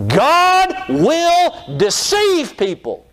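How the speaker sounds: very high, drawn-out proclamation; preaching cry. Real, not acted. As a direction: stretch each word out at high pitch. Preacher drives home his shocking point.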